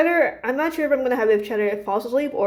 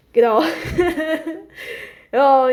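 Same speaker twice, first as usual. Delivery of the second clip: ordinary talk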